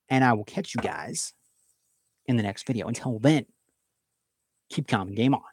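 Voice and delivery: quiet closing catchphrase; soft sign-off. Real, not acted. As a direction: ease off into a soft goodbye.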